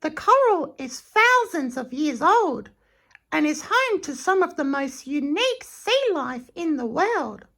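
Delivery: high, sing-song guide voice; Dilly the barge. Acted. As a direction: show off the reef in a high voice. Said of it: "said Dilly".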